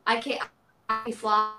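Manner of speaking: usual speaking voice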